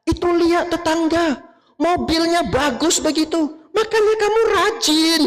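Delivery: high-pitched nagging scold; nagging wife. Acted. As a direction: nag him about the neighbour's car. Istri mengomel soal mobil tetangga. (istri mengomel dengan suara tinggi soal mobil tetangga)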